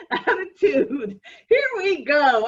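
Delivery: laughing through the words. Real, not acted. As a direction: giggle while you say it. Laughs while repeating word.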